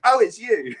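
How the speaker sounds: high, loud startled recognition; laughing shock at seeing him; mock surprise.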